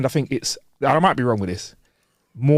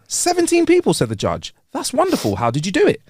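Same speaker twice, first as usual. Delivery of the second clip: amazed judge; 11 semitones higher than usual. Acted.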